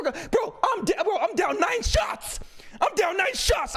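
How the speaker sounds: put-on high-pitched, drawn-out drunk complaint; exasperated protest. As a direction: cry it out in disbelief, stretching the words. Acted protest about being drunk.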